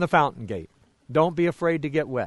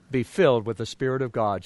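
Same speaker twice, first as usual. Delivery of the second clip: normal talking voice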